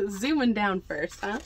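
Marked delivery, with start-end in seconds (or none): none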